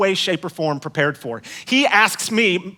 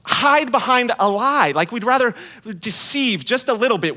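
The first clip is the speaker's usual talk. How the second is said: preaching; 5 semitones higher than usual. Acted. Pastor urging the congregation about hiding weakness, earnest preaching. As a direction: drive the lesson home.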